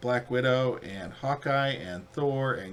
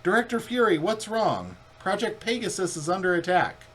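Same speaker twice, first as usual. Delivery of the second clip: higher, louder alarmed report; urgent radio reply; 8 semitones higher, 4 dB louder than usual. Acted. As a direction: sound the alarm over the radio. Voicing a character replying to Fury.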